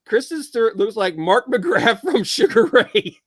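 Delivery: reading slowly through laughter, voice rising; amused, breaking into a laugh; cracking up. Real, not acted. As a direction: laugh your way through the comment. Reads listener comment, cracks up.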